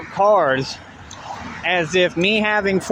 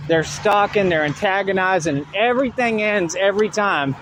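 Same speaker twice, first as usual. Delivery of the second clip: normal talking voice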